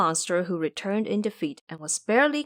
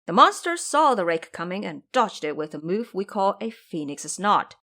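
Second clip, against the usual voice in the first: usual speaking voice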